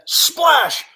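loud, drawn-out splash; splash effect. Acted. Storyteller acting out the dive, loud splash effect. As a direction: burst out the splash loudly.

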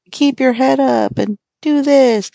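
high, slow sing-song fake cheer; mock positivity. Mocking toxic-positivity preachers, slow high fake cheer. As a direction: parody a toxic-positivity guru. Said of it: "where it's like".

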